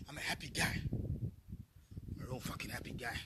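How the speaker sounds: low, slow, whispered sarcasm; sarcastic whisper. Real, not acted. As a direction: whisper it bitterly, deadpan mockery. Sarcastic about being happy.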